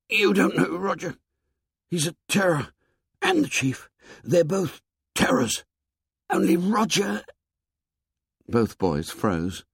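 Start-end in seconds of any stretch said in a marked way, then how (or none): 0.1-7.2 put-on high, strangled, frightened boy. A narrator's reading. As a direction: squeeze the words out from a tight throat. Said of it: "Sam spoke in a strangled voice".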